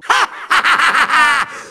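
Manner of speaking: long rattling villain laugh; theatrical rapid-fire evil laughter; evil cackle. Acted. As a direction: cackle in gloating triumph. Villain gloats.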